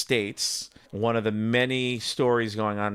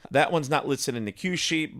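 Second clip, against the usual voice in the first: usual speaking voice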